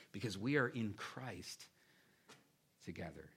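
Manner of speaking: ordinary talk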